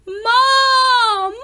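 high wailing shout; desperate cry. A narrator's reading. Splat crying out to mom, high wail.

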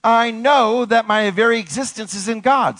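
high, ringing declaration; emphatic affirmation. Shown as a demonstration. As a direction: proclaim it to lead the congregation. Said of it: "Say it".

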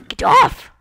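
high, loud yell; shrill yell. Acted. Gamer yelling at a unicorn to get off. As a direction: shout at the unicorn to scram.

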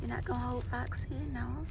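playful coo at the little one. Real, not acted. Mom talking to baby about toy.

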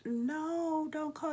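high panicked "no, don't call"; panicked plea. Retold or imitated. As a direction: plead in a rising panic. Begging nurses not to call ambulance.

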